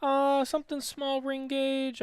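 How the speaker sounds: high, drawn-out hemming and hawing; slow, high-pitched mock deliberation; mock hesitant picker. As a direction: imitate someone coyly taking their time choosing. Imitating Jose choosing a cigar.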